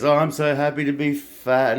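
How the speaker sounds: high mocking voice of the model; lightly mocked girly gushing; mocking fat model. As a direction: sneer it in her voice. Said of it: "and she goes".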